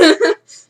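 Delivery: loud laugh. Real, not acted. Laugh after quote.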